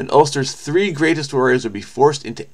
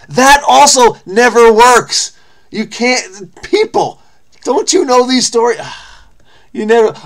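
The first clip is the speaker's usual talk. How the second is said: exasperated scolding; 9 semitones higher, 6 dB louder than usual. Real, not acted. Scolding the characters in the story.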